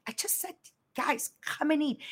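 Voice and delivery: soft, innocent. Retold or imitated. Mother retelling her text.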